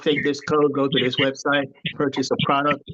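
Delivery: ordinary talk, nothing marked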